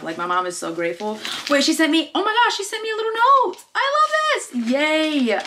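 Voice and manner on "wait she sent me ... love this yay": high, excited squealing; thrilled squeal. Real, not acted. Vlogger finding friend's little note, thrilled squeal. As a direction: squeal with delight at the surprise.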